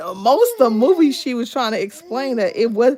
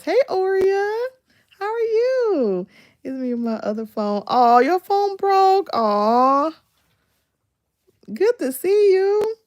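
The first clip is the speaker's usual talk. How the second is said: high, slow cheery hello; cheery greeting; 9 semitones higher than usual. Real, not acted. Vlogger greeting a viewer live, high and sweet.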